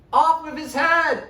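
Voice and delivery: high, loud, slow proclamation; mock royal shout. Boy king orders the traitor's execution in a booming shout. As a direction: bellow it like a tyrant king's decree.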